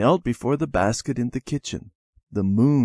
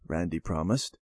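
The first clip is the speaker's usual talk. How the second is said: ordinary delivery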